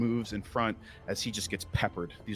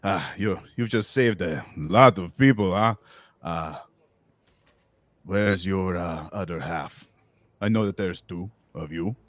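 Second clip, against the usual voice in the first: lightly voiced pleased villain; walrus man villain; 4 dB louder than usual. Walrus man questions the tide swell who came out, pleased villain. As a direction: savour it, then probe with pauses.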